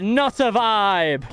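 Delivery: high, slow and stretched out; slow drawn out. Pretend. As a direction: drag every word out, up high. Drawn-out repeated refusal.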